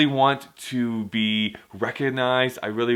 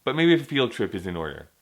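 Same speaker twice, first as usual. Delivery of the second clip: usual speaking voice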